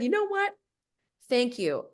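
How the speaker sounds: a sudden high, clipped "thank you" that ends the talk; curt brush-off. Retold or imitated. Retelling the call, she ends it with him in a high, pointed voice.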